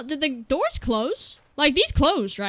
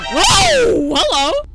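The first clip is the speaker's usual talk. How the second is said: high, loud yelp of greeting; shrieked surprise; startled shout; 11 semitones higher, 11 dB louder, much slower than usual. Real, not acted. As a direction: shout it at a sudden fright. Monster appears suddenly.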